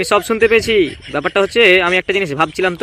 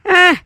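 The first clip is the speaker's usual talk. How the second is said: surprised ah; 12 semitones higher, 5 dB louder than usual. Acted. Startled reaction to teasing.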